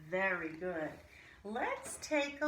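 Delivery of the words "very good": a long breathy h before "Very good"; breathy h sound. Shown as a demonstration. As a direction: breathe out an h, then praise. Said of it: "h sound demo for kids".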